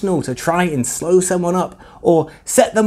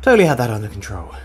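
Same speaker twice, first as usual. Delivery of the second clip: sarcastic; 6 semitones lower, much faster than usual. Acted. Sarcastic quick aside.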